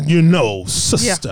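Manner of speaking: loud mock-preacher delivery. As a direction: put on a preacher addressing the congregation. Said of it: "That's like a preacher voice".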